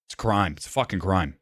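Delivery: ordinary talk, nothing marked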